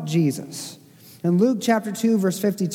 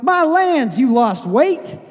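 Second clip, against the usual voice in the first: thick Southern drawl; 6 semitones higher, 5 dB louder, slower than usual. Retold or imitated. Pastor blurting to an old acquaintance, thick Southern drawl. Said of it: "in the thick Southern drawl".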